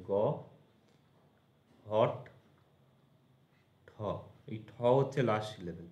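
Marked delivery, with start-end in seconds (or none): 0.1-3.9 গ, হট, ঠ with long pauses; each syllable standing alone. Shown as a demonstration. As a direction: sound out গহট্ট as three isolated syllables: গ, হট, ঠ. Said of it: "গহট্ট syllable কী হবে?".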